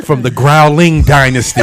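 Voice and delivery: slow shout through laughter; laughing shout. Real, not acted. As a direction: bellow it while cracking up. Kung fu title joke.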